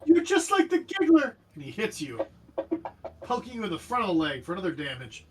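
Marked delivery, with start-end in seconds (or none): 0.1-1.3 put-on high, loud child's accusation; shrieky little attacker; angry kid. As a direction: accuse them in a raised kid voice.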